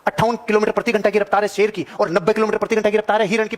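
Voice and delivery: ordinary talk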